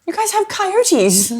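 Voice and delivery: high, slow teasing comeback; smug comeback. Retold or imitated. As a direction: drag it out, pleased with yourself.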